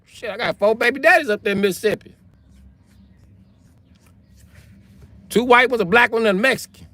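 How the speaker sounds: high-pitched mock woman's voice; Mississippi woman. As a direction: play her in a high, exaggerated Southern voice. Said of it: "She'll be like".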